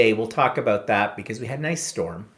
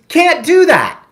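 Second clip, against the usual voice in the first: loud, high imitation of a scolding machine; berating machine; 11 dB louder, slower than usual. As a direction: shout the refusal like a bossy card terminal. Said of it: "being berated by a machine".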